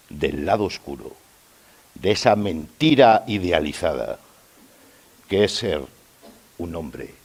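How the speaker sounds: pausado, con largas pausas y cadencias descendentes; monólogo teatral recitado. Acted. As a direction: recita como un poema confesional, creciendo en los golpes y cayendo al final. (recitado teatral solemne)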